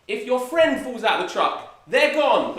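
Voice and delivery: high, loud mock-grave joke; dramatic punchline. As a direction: build it up for the joke.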